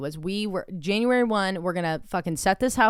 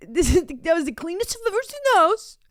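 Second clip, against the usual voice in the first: high, loud mocking whine; partner impression; 11 semitones higher, 4 dB louder than usual. Mocking partner praising the other house, high whine. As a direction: whine it in an exaggerated squawky voice.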